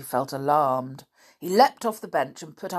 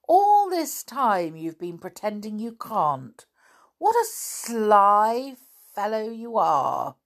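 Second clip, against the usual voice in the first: slow, higher, louder accusing; indignant accusation; 6 semitones higher, 5 dB louder, slower than usual. A narrator's reading. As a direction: drag out the accusation with outrage. Boy exposes servant's fake deafness.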